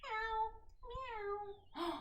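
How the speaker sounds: put-on high cat meow for the children. Shown as a demonstration. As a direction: mew like a playful cat. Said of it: "he saw a cat".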